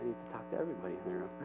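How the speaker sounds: very soft, quick self-talk; hushed aside. Retold or imitated. Speaker telling himself to include the room, hushed aside. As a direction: mutter it low and fast, as a private reminder. Quoting own thought as an aside.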